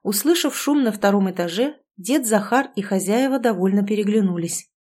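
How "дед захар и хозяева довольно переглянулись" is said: ordinary delivery, nothing marked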